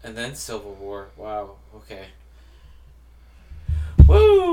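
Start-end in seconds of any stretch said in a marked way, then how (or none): 4.1-4.5 excited whoop. Real, not acted. Cheering at end of list.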